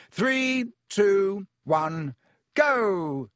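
high, spaced-out count, stepping down; shouted countdown. A narrator's reading. Lockhart starting the duel, shouted countdown. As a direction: call the count out high, then launch "go". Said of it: "he shouted".

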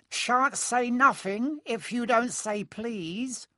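high, slow taunting chant; sing-song it to annoy, pitched high; Peeves, annoying sing-song. A narrator's reading. Peeves refusing to tell Filch anything, sing-song. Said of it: "said Peeves in his annoying sing-song voice".

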